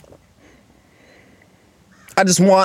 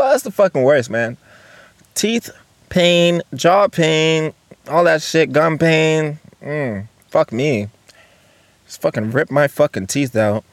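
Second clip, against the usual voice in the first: ordinary talk, nothing marked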